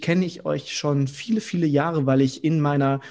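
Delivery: ordinary talk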